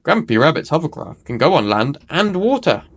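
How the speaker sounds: ordinary delivery, nothing marked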